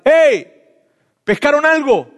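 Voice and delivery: loud, high call across the water; Jesus calling out to the fishermen from the shore, loud and high; shouting from the beach. Retold or imitated. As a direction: hail the boat from far off, high and loud. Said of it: "Jesús les grita desde la playa".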